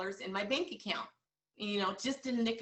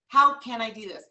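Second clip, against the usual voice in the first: louder, punched and emphatic; louder, stronger take; 11 dB louder than usual. Shown as a demonstration. Coach repeating her own phrase, louder and emphatic. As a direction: drive it home harder. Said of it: "you know what [name] said".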